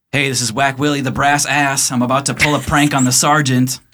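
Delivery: brash loud guard. Acted. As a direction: announce the prank loud and proud. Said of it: "he says".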